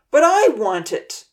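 loud, slow, high protest; shouting. A narrator's reading. Odin shouting at Ponzi over the hill, loud and slow. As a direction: shout it like a boy who won't give in. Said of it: "Odin shouted".

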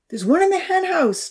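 put-on high child's calling voice; called out. A narrator's reading. Charlie calling up from the roof, high call. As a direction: call it out to the others. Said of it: "Charlie called out".

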